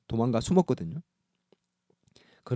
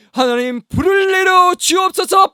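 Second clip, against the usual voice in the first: much higher and louder, slow cry to God; shouted prayer; 8 dB louder, slower than usual. Retold or imitated. Elijah crying out for fire from heaven.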